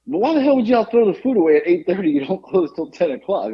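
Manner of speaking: quick, high-pitched retold complaint; mock outrage, rushed at first then settling; pissed off. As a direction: act out an indignant customer demanding an explanation. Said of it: "now he's pissed".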